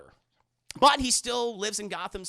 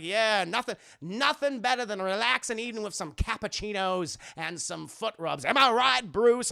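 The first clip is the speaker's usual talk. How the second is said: put-on Southern accent. Rossum chatting up Bruce over cappuccinos, hearty Southern accent. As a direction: play a chummy Southern host. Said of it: "He has a very thick Southern accent".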